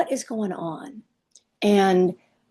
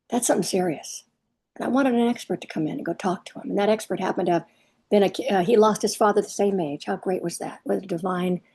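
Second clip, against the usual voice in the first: ordinary talk, nothing marked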